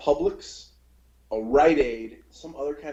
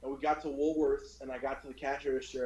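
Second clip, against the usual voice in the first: ordinary talk, nothing marked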